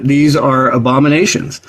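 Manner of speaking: ordinary delivery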